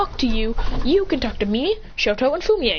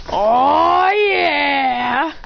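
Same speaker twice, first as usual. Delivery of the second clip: louder, higher drawn-out oh yeah; gleeful cry of yes; proud son reveals the cat gift, loud and high; excited; 5 semitones higher, 5 dB louder than usual. Acted.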